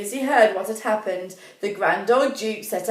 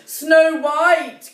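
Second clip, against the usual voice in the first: worried cry; 8 semitones higher, 8 dB louder than usual. A narrator's reading. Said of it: "cried Doctor Charming".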